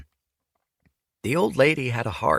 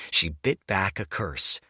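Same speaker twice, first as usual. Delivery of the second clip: ordinary delivery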